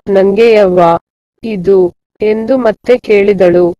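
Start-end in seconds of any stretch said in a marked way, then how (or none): none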